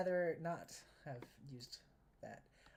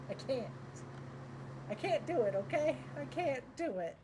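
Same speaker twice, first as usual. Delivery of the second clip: higher and louder exasperated protest; 7 semitones higher, 4 dB louder than usual. Real, not acted. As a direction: throw up your hands at the game. Frustrated with the terrain.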